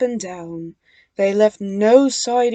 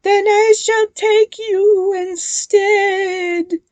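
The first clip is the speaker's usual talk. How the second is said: slow, high, loud menacing hiss; ghost hissing; 11 semitones higher, 8 dB louder, slower than usual. A narrator's reading. Ghost threatening the twins, hissing. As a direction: threaten them slowly like a ghost. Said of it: "hissed the ghost".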